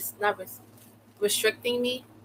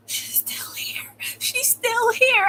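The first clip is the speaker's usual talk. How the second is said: slow, breathy stage whisper; drawn-out hushed plea, rising high; mock frightened whisper; much slower than usual. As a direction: imitate a caller secretly reporting someone. Imitates him whispering to the dispatcher.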